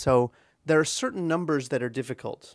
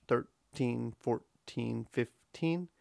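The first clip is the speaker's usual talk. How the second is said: "thirteen, fourteen, fifteen" in equal left-right beats; "thirteen, fourteen, fifteen" as a straight, measured march; marching rhythm; 2 semitones lower, 6 dB quieter, slower than usual. Shown as a demonstration. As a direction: step evenly through THIR-TEEN, FOUR-TEEN, FIF-TEEN. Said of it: "more like a march".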